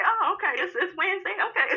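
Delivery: high, airy, teasing voice; mock breezy reply to husband. Sassy wife brushing off husband's other woman. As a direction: act unbothered. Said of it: "just say".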